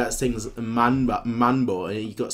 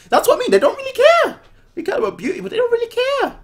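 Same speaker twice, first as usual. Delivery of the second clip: much higher and louder, mock outrage; squeaky mocking rant; 8 dB louder, faster than usual. Real, not acted. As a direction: squeal it in exasperated disbelief. Mocking the careless royals.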